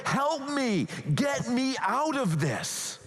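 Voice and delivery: high, slow pleading cry; desperate plea. Retold or imitated. Preacher voicing Jesus begging to be rescued, high and pleading. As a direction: beg as if at the end of your rope. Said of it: "He is desperate for this to end".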